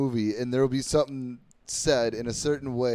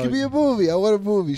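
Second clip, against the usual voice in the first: much higher, louder eager demand; excited; 7 semitones higher, 6 dB louder than usual. Real, not acted. Urging his friend to pick a movie, excited and loud. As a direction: push for it excitedly.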